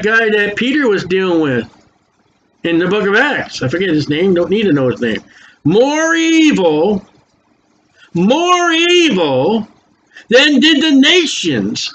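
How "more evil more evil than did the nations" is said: drawn-out emphasis. Acted. Preacher dwelling on scripture.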